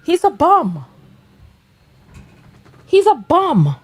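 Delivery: loud derisive singsong put-down; mocking verdict. Real, not acted. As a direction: crow it high with a swooping fall. Mocks the man in clip.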